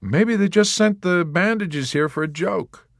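high, light teasing; Dunbar. A narrator's reading. Dunbar teasing the nurse, light. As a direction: toss it off lightly.

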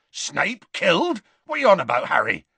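can't believe his ears; incredulous Hagrid. A narrator's reading. Hagrid to Harry about Snape, incredulous.

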